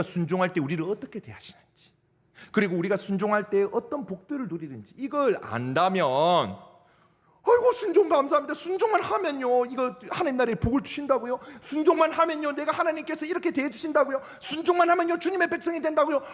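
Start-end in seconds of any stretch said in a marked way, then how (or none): 6.1-16.2 overjoyed believer. Retold or imitated. Preacher voicing a believer thrilled by obedience, high and delighted. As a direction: gush with joy at the blessings of obedience. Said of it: "할 거예요".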